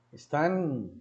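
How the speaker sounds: usual speaking voice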